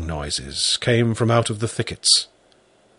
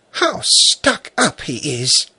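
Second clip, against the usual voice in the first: put-on slow, high disapproving girl; 5 semitones higher than usual. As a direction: tut at him like a little girl. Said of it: "remarked disapprovingly".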